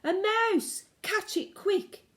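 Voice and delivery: high, loud alarmed shout. Acted. Cooks spotting the fleeing mouse, shouting high. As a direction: cry out in alarm. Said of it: "they were shouting".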